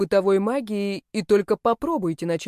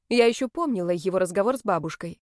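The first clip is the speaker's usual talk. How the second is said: ordinary delivery, nothing marked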